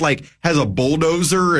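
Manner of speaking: ordinary talk, nothing marked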